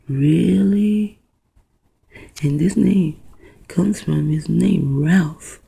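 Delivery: ordinary delivery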